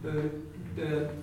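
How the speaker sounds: Oo said with unrounded lips. Shown as a demonstration. Teacher isolating the northern vowel, unrounded oo. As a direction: hold Oo briefly without pursing the lips. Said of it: "an unrounded oo sound".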